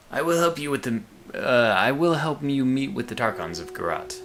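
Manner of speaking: lightly put-on game character voice; a hint of an unplaceable accent for another character; different character voice. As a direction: voice him a little apart from yourself. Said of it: "give other people a different voice".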